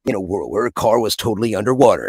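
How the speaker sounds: dramatic movie-trailer announcer; Don LaFontaine trailer voice. Retold or imitated. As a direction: boom it out like an epic trailer narrator. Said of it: "like the old Don LaFontaine".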